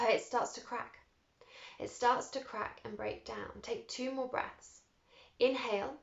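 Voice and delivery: calm breathwork guide voice; guided breathwork. Acted. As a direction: coax each breath gently. Leads a breathing meditation.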